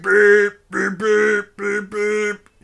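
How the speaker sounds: high beeps like a car horn; short piping horn beeps; imitated car horn. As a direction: mimic the honking car.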